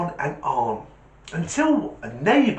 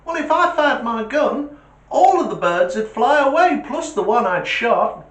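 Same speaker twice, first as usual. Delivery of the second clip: high, bright schoolboy voice, pitched up and loud; cheeky kid voice; 10 semitones higher, 6 dB louder than usual. Acted. Schoolboy answering his teacher in a high pushed-up voice. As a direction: play the know-it-all kid answering back.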